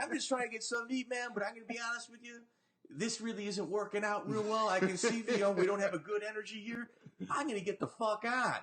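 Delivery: a hint of nervous diplomacy; retold self. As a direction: talk your way out politely. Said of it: "I'm like".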